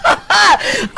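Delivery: laughing through the words; real laughter over a joke. Real, not acted. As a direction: giggle while you talk. Laughing at the segue.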